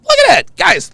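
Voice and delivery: a real burst of glee; excited. Real, not acted. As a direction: point it out, thrilled. Excited about Kevin's reaction.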